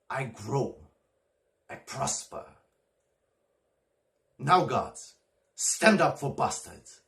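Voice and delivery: Shakespearean soliloquy with long, weighted pauses; Edmund, resentful and scheming. Acted. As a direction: swing from hushed brooding to a loud, defiant appeal. Performs Edmund's monologue from King Lear.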